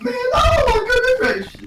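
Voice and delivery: very high-pitched acted alarm; mock shock. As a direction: exclaim in falsetto horror, hammed-up. Acts shock at hippo attack.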